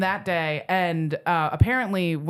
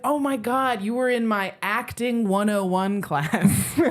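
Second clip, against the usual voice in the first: high-pitched retold excitement, breaking into a laugh; retold astonishment ending in laughter; amazed recognition; 4 semitones higher than usual. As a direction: blurt out the realization, imitating an amazed friend. Dad retold placing the stranger.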